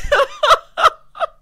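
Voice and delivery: loud high burst of laughter; gleeful cackle. Real, not acted. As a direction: cackle loudly at a great comeback. Laughs at the retort.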